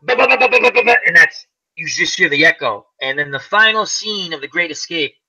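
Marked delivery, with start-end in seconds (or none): 0.0-1.0 high rapid ba-ba-ba gunfire imitation; imitated machine gun rattle; machine gun sound. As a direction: mimic the gun's bursts, pitched up. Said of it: "you hear".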